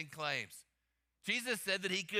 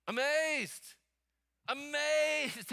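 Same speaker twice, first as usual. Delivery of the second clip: high, drawn-out emphatic call; said out loud; 7 semitones higher, 4 dB louder, much slower than usual. Shown as a demonstration. Preacher leading congregation in the next word, out loud. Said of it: "Everybody say it out loud".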